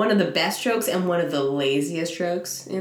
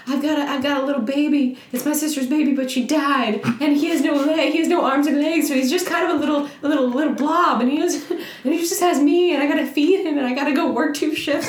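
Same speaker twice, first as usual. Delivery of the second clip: high, pitiful squeaky plea, a bit loud; pleading little shrimp; 10 semitones higher, 4 dB louder than usual. Retold or imitated. Shrimp begging the shark boss for its life, high and pitiful. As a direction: beg for mercy in a tiny high voice.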